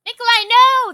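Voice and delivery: high, very loud cry to stop someone; urgent in-character shout. Acted. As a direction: shout while trying to grab him. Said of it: "That's what I'm saying as I'm".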